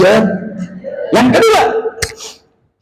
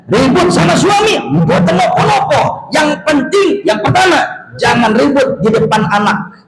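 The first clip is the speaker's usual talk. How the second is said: higher, rising preacher's call; fiery preaching; 6 semitones higher than usual. Acted. Kiai warning the congregation about quarrels, fiery preaching. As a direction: preach it out to the crowd.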